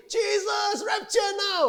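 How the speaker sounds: very high-pitched wailing shout; mock desperate plea. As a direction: cry to heaven like someone fed up with troubles. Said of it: "when you are brokenhearted". (teriakan memelas yang dibuat-buat)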